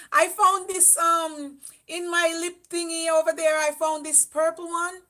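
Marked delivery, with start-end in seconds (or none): none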